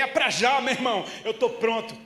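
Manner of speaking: a runner eagerly accepting a stranger's hug. Retold or imitated. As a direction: jump in with joy. Eager reply to the hug request.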